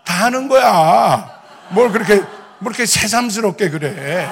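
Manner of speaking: loud, drawn-out friendly scoff; dismissive senior teasing. Retold or imitated. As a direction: brush off a junior's big news, stretching the endings. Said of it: "다 저희 선배 목사님들인데". (별것 아니라는 듯 크게 늘여 놀리기)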